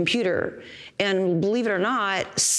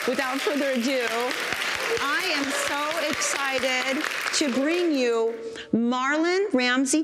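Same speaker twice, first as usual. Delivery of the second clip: announcer voice; 6 semitones higher than usual. Acted. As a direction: hype the guest's entrance. Host introduces guest.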